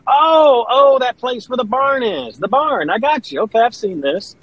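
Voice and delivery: high-pitched, excited "oh, now I get it"; sudden recognition. Retold or imitated. Son finally placing dad's Grand Ole Opry, high-pitched recognition. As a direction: re-enact a dawning realization, pitched well up. Acting his own past reply to dad.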